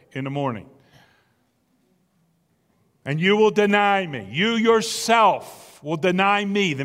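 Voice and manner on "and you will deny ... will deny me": weighty stress on "You yourself" and "me"; pointed accusation landing firmly on "me"; emphatic "me". Acted. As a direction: lean hard on "deny me", drawing out "yourself". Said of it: "is very emphatic".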